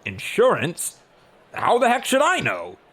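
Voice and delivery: loud, high, incredulous outburst; exasperated, booming comeback; indignant god. A narrator's reading. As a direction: blurt it out as an affronted god. God angrily demands, loud and high.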